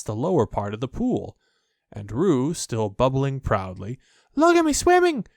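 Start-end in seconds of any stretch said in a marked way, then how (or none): 4.3-5.3 very high, loud squeak; squeaky little Roo. A narrator's reading. Roo showing off his swimming, high loud squeak. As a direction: squeak it like a proud tiny joey. Roo shouts while floating.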